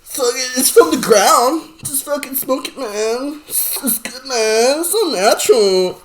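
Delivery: high, dreamy mock stoner voice; mock stoner hippie. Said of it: "I make fun of that culture".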